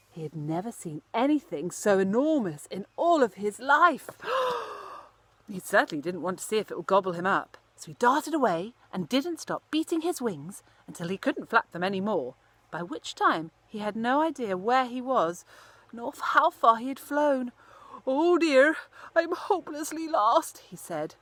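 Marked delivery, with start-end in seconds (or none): 4.1-5.0 sharp high gasp of fright; frightened gasp. Acted. Scared by lorry. 18.1-20.6 higher, louder tearful lament of a lost bird. Acted.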